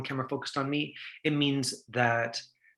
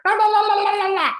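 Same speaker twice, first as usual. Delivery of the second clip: high, loud mock babble; mom rattling on; 9 dB louder than usual. Coach imitating mom going on over the phone, loud high babble. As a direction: run the syllables together in a shrieky stream. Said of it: "your mom going like".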